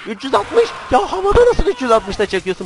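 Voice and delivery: high, loud disbelief; incredulous outburst. Real, not acted. Player amazed at a midair trick shot, loud incredulous yell. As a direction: exclaim it high and loud, astonished.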